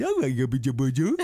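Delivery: mock cooing to a newborn; silly goo-goo baby chatter; baby talk. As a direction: babble at a baby, tongue-in-cheek. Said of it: "like they speak to a baby".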